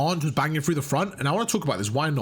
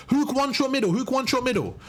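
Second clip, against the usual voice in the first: raised, higher game callout; mock callout; 7 semitones higher than usual. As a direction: call it out over comms. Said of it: "Vivid's gonna call out".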